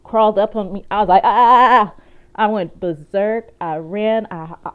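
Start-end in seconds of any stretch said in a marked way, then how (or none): 1.2-2.0 loud, re-enacted yells of panic; panicked scream. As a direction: scream as if spiders are crawling on you. Acting out panic as spiders crawl up.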